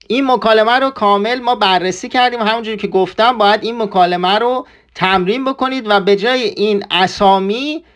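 ordinary talk

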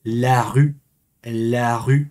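la rue said as a deliberate, separate demonstration; French pronunciation. Shown as a demonstration. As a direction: model la rue with a rounded French u. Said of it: "en francés se dice".

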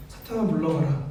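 낮고 작은 목소리로 무표정하게; 전자음처럼 감정을 빼고 읽기. Acted. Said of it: "예수님이 이 표정에서 이렇게 했을까요". (감정 없이 낮게)

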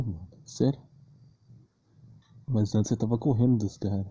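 normal talking voice

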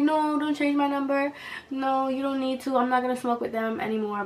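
imitated whiny teen plea; mock pleading. Retold pleading with dad.

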